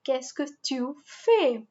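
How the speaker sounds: slow, careful qu'est-ce que tu fais; informal model. Shown as a demonstration. Teacher modelling the informal question, slow.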